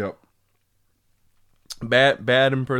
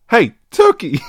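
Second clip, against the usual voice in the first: louder, higher yell in an attempted British accent; British accent retake; 6 semitones higher, 8 dB louder than usual. Impressionist calling to a turkey again, British yell. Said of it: "losing the British accent completely".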